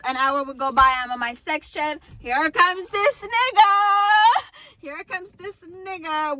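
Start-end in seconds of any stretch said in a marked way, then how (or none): 2.2-6.4 dramatic dread. Retold or imitated. Dramatic dread at his approach.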